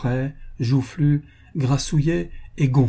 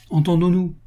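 higher-pitched, lively interjection; brisk retort; 8 semitones higher than usual. A narrator's reading. Duke correcting madame de Jouan, brisk retort. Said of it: "le duc reprit vivement".

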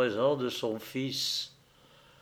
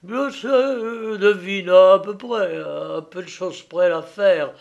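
raised, loud and high to drown a voice; speaking loudly over him; 6 semitones higher, 6 dB louder than usual. A narrator's reading. Son speaking loud to drown out his father. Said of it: "se hâta de parler haut pour étouffer sa voix".

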